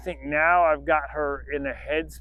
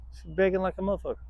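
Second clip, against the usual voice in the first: usual speaking voice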